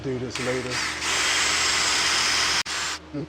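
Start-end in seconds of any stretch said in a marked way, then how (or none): none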